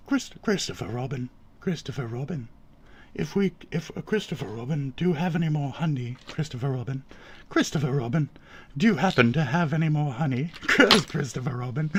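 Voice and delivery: very soft bumbling bear; storybook bear voice. Shown as a demonstration. Bear asking Christopher Robin for honey, soft character voice. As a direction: murmur it like a sweet, hungry bear. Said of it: "the general tone you give to that voice".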